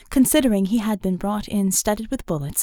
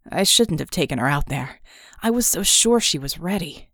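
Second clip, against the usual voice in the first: lower, dry teasing man's voice; wounded wry man; 3 semitones lower, 3 dB louder than usual. A narrator's reading. Red ribbing Bella after their kiss, dry and low. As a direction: tease her through the pain. Red's voice.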